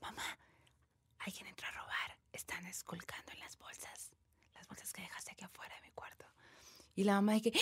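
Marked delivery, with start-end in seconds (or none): 0.0-6.2 frightened unvoiced whisper. Scared girl whispering to her mom about a burglar. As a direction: whisper it so the thief can't hear. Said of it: "le dice súper bajito".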